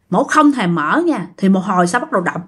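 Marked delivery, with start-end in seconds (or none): none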